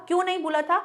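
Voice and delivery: normal talking voice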